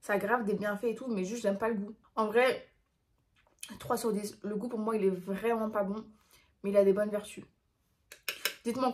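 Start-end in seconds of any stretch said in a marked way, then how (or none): none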